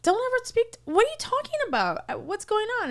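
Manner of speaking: mock flustered protest; retold confusion. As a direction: squeak it out in disbelief. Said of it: "And I'm like".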